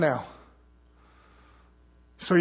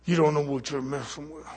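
low menacing devil; 4 semitones lower, 5 dB quieter than usual. Retold or imitated. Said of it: "go into the beast realm".

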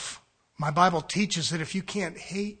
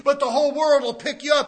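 ordinary talk

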